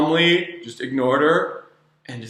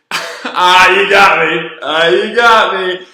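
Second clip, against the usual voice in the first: playful surrender; 4 semitones higher, 7 dB louder, slower than usual. Retold or imitated. Retold kid gives in, amused.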